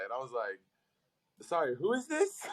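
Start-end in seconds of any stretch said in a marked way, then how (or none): 1.5-2.5 much higher, puzzled who-is-this; baffled question. Retold or imitated. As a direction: act lost and confused on the phone. Retold confused reply to caller.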